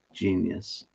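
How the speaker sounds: ordinary talk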